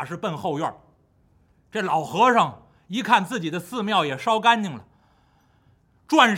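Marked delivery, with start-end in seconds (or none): none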